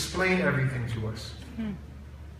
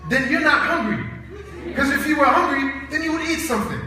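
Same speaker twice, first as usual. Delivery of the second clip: exasperated dad; 9 semitones higher than usual. Retold or imitated. Dad voiced in a dinner argument.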